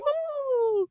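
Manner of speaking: very high soft ooh. Retold or imitated. Hearing about a development deal, a high soft ooh. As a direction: let out a tiny high ooh. Reacting to a network call.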